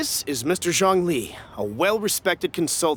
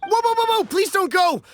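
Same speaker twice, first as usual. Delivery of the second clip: high, louder, rushed whoas; panicked plea; 13 semitones higher, 4 dB louder than usual. Acted. Merchant begging the seller not to walk away, panicked. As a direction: scramble to stop her leaving.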